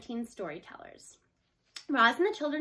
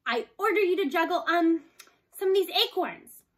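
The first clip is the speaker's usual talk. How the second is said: loud, higher playful order; bossy command; 6 semitones higher, 7 dB louder than usual. A narrator's reading. Girl ordering the robot to juggle acorns, loud and playful. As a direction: boss the robot, improvising mid-line.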